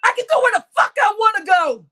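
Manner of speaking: ordinary delivery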